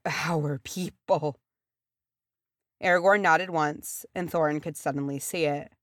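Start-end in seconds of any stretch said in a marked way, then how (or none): 0.1-1.4 slow, fading, hushed repeat; trembling lips. A narrator's reading. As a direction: let it fade slowly, lips trembling. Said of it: "his lips trembled".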